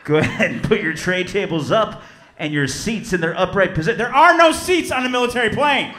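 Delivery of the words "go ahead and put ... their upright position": lightly voiced smooth airline captain; a hint of a calm intercom pilot; mock pilot announcement. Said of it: "be like".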